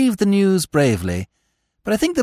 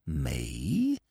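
low, soft, feigned innocence; hushed innocent me; 12 dB quieter than usual. Acted. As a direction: murmur it as if wounded. Steggles feigns innocence, first take.

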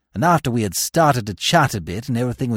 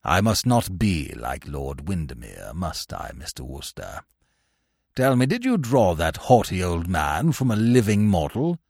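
put-on mollified old uncle; 9 semitones lower, 4 dB quieter than usual. A narrator's reading. Old uncle questioning Wooster about a character, low and musing. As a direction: muse deep and gently, then ask.

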